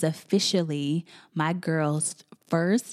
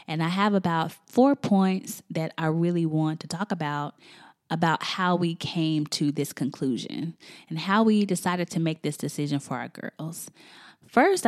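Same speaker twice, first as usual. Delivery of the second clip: ordinary delivery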